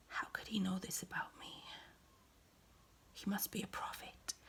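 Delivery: soft, low whispered telling; hushed whisper. Acted. Woman retells how he knew her husbands, whispering. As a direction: whisper it like a secret revealed.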